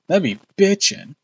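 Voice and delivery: pumped, high and loud; excited. Real, not acted. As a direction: hype it up. Excited gamer talk.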